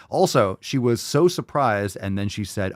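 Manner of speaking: ordinary delivery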